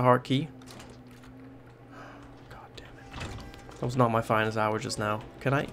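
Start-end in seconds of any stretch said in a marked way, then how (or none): 2.5-3.3 1 whispered curse. Real, not acted. Muttered curse after mistake.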